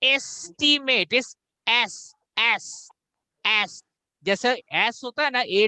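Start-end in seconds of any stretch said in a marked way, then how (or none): 0.0-1.1 "estimate" with a long AY opening, "ace-timate"; slow, higher wrong example of "estimate"; mispronunciation demo. Shown as a demonstration. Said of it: "it's not". 1.7-3.8 "S" said like the word "ass", open A; separate, held repeats of "S" with an open A; open vowel demo. Shown as a demonstration. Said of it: "उसका जो sound होगा".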